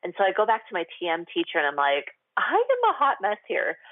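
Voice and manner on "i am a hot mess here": high, frantic venting; exasperated outburst. Retold or imitated. Exasperated outburst to her meditation teacher. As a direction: tell the teacher she's a hot mess.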